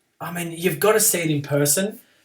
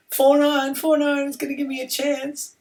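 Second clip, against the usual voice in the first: higher mocking voice of a gloating rival; imitating a gloating opponent; 12 semitones higher, 4 dB louder than usual. Mocking the opponent Aloysius, higher gloating voice. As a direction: tease it up high like the rival is gloating. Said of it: "Aloysius is probably laughing".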